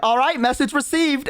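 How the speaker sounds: goblin voice. Retold or imitated. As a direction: back off cheerfully as the high goblin. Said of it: "And he's like".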